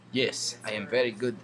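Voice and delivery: light put-on Russian accent. Gamer playfully praises own skill, mock Russian accent. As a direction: try on a Russian accent, casually. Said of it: "talk in my Russian accent".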